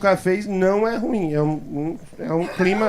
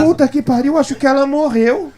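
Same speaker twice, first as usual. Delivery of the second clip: imitated panic; 5 semitones higher, 6 dB louder than usual. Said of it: "veio chorando".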